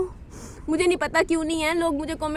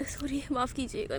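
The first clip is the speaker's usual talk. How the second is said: crying; 2 semitones lower, 9 dB quieter than usual. Real, not acted. Mother crying on camera apologizing.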